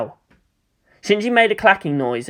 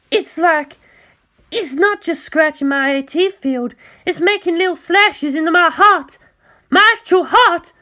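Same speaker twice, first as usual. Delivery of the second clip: put-on much higher, frantic young pilot in pain; panicked girl's voice; 13 semitones higher than usual. Mayumi crying out to Asuka's attack in high panic. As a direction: squeal it out as the field is torn.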